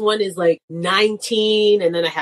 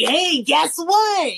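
teasing drawl; 9 semitones higher, much slower than usual. Retold or imitated. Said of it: "and I'm like".

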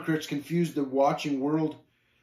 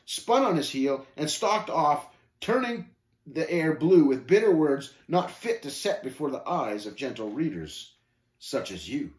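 plain reading voice

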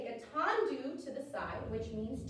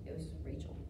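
quick hushed aside to a colleague; whispered aside; 9 semitones lower, 6 dB quieter, faster than usual. Real, not acted. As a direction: whisper it off to the side.

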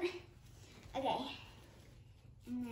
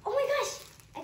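high, louder burst of excitement; excited; 10 semitones higher, 5 dB louder, slower than usual. Real, not acted. Overwhelmed by so much doll stuff, excited. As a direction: exclaim it high, carried away. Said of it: "I'm getting a little excited".

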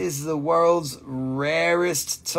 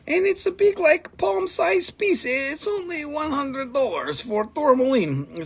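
excited pitch; 9 semitones higher than usual. Real, not acted. Showing off a big crystal.

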